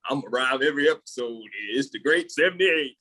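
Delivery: fast, hammed-up imitation of the host; mocking host. Cohost imitating the host's rhyming intro, mocking. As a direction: parrot him in a silly put-on voice. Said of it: "Bruh, Pod Tail is way cooler than".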